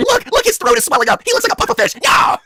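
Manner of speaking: high, hammed-up yell; gleeful shouting. Wife gloating over swollen throat, high gleeful shout.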